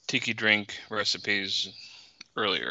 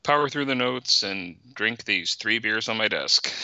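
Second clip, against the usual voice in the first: usual speaking voice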